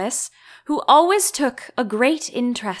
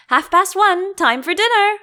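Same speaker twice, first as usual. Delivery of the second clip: excited; 11 semitones higher, 6 dB louder than usual. Acted. Hatter excitedly imagining dinner time.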